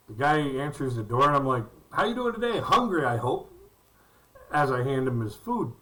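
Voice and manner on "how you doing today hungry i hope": cheery greeting. Retold or imitated. Greeting customer at door.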